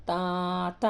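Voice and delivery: "తాత" with a long held first syllable; drawn-out vowel. Shown as a demonstration. Teacher showing where to lengthen, drawn-out "తాత". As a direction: stretch "తా", then a short "త". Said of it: "ఇక్కడ సాగదీసి పలకాలి".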